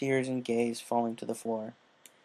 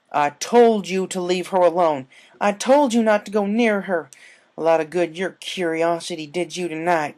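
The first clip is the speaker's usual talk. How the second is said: angry yell; 6 semitones higher, 9 dB louder than usual. A narrator's reading. Applejack yelling at the fillies, furious and loud. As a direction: yell at them for not listening. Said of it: "she yelled at them".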